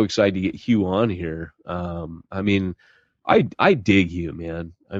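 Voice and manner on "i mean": ordinary talk, nothing marked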